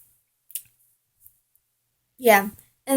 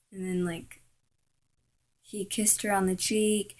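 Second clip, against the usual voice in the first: ordinary delivery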